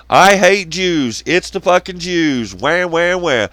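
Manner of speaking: loud, slow mock whine; mocking whiner. Mimicking YouTube complainers in a loud, slow mock whine. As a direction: parody the complainers with a whiny "wah". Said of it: "all I'm gonna ever hear from anyone is".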